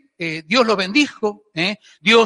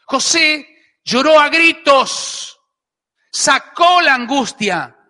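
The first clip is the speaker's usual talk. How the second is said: high, slow, raised-voice proclamation; preacher dramatizing an anguished outpouring; impassioned preaching; 5 semitones higher, 4 dB louder, slower than usual. A narrator's reading. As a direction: stretch each phrase out with rising force. Preacher dramatizes Joseph's loud outpouring.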